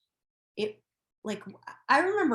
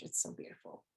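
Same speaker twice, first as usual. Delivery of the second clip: "it's so beautiful" breathed as a soft unvoiced whisper; 10 dB quieter, faster than usual. Real, not acted. Repeats the phrase as a whisper.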